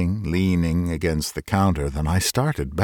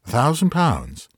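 higher, half-laughing disbelief; incredulous; 6 semitones higher than usual. A narrator's reading. Doctor repeats the stranger's offer in laughing disbelief. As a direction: toss the words back, amazed.

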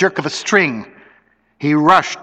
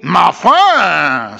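Bienvenu; 4 semitones higher, 4 dB louder than usual. A narrator's reading. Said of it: "said the swaying Bienvenu".

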